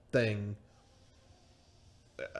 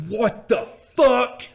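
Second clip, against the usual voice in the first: high, loud, drawn-out exasperation; pissed-off outburst; 11 semitones higher, 8 dB louder, much slower than usual. Retold or imitated. Boxing promoter raging about the vanished driver, pissed-off outburst. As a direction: explode slowly, leaning on every word. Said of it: "I'm so fucking pissed off".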